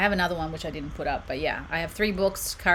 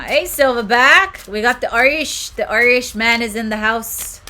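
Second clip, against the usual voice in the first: loud, higher mock Irish accent; terrible Irish accent; 4 semitones higher, 9 dB louder than usual. Streamer greeting an Irish viewer, terrible Irish accent. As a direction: hail them in a bad Irish accent. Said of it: "Terrible Irish accent".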